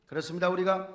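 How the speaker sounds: ordinary delivery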